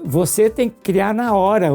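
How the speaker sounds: ordinary talk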